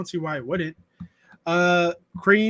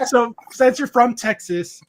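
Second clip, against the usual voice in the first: usual speaking voice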